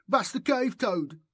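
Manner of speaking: high, jeering schoolboy voice; high mocking child's jeer; mocking boy's taunt. A narrator's reading. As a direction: sneer it like a bully in the yard. Boys taunting Bass.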